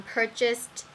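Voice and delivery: usual speaking voice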